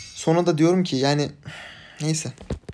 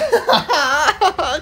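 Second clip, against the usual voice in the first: high laugh; 11 semitones higher, 4 dB louder than usual. Real, not acted. After screaming.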